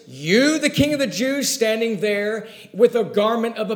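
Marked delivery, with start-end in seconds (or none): 0.1-1.5 a scoffing you, the king, higher at the end; mock the idea of his kingship; incredulous Pilate. Pilate scoffs at the bound peasant before him. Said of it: "it's ludicrous in his mind".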